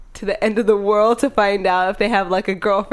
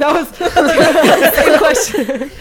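higher, louder, slow through laughter; laughing; 8 semitones higher, 6 dB louder, much slower than usual. Real, not acted. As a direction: crack up while getting the words out. Laughing at own confusion.

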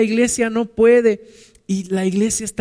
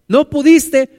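louder, higher mock boss; mock loud dismissal; 7 semitones higher, 9 dB louder, slower than usual. Preacher imitating a boss firing him, loud mock dismissal. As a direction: bark it like a curt boss.

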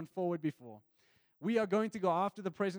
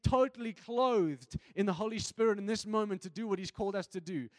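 usual speaking voice

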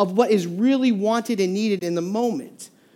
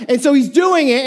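normal talking voice

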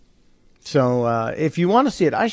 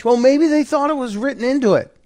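much higher mock-innocent aside; sarcastic; 10 semitones higher than usual. Real, not acted. Host mocking the audience, high sarcastic aside. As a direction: play dumb on their behalf.

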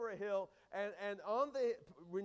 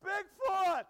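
shrieking "Bigfoot!" much higher and louder; retold scream; 10 semitones higher, 12 dB louder than usual. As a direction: yell "Bigfoot!" in a sudden panicked shriek. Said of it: "She screamed and she said".

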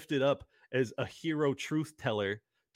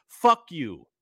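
louder, forceful outburst; real anger; 7 dB louder than usual. Real, not acted. Host telling off those asking for amnesty, angry. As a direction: hit it hard as a furious dismissal. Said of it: "I am so mad".

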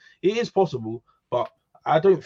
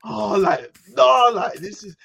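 higher, louder fed-up "oh no"; exasperated outburst; 5 semitones higher, 6 dB louder, slower than usual. Retold or imitated. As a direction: burst out in disbelief. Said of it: "You're just like".

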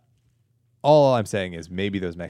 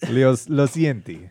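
higher, louder mock-Italian accent; 5 semitones higher, 5 dB louder, slower than usual. Shown as a demonstration. Podcaster fakes an apology to Italian listeners, mock Italian. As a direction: slowly ham up an Italian accent. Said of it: "but in Italian".

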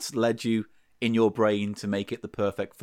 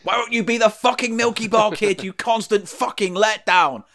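screaming mother; 11 semitones higher, 4 dB louder than usual. Retold or imitated. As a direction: shriek it like a furious mum. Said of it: "That was my mantra growing up".

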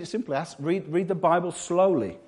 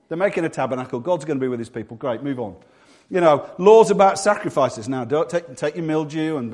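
normal talking voice

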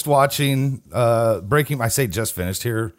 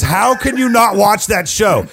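raised, louder, incredulous scolding; mock indignation; 6 semitones higher, 5 dB louder than usual. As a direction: imitate an appalled fan lecturing a friend. Retells friend's indignant scolding.

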